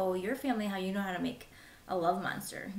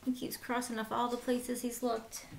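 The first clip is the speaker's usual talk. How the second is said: plain reading voice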